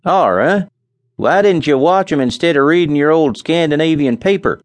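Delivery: a farmhand's teasing grumble; Charlie's voice. A narrator's reading. Charlie scolds his partner over the paper. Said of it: "answered Charlie".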